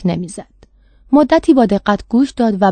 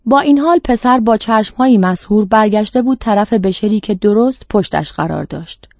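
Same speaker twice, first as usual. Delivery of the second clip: ordinary delivery, nothing marked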